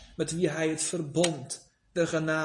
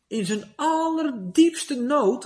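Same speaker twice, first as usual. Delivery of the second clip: slow and higher, every word weighed; slow emphasis; 7 semitones higher, 4 dB louder, slower than usual. Retold or imitated. Preacher tells of Luther's deepest need, slow and raised. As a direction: lean on it slowly, as the gravest part. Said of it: "zei Luther".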